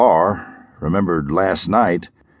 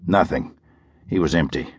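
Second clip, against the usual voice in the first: ordinary delivery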